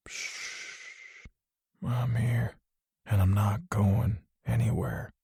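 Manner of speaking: hushed, soft comfort; a father softly calming his son; softly spoken. A narrator's reading. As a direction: soothe him gently, barely above a breath. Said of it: "he softly spoke".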